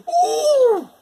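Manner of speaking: very high, loud pained yelp; wolf landing in boiling water; wolf's yelp of pain. Acted. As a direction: yelp as if scalded. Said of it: "with a yelp of pain".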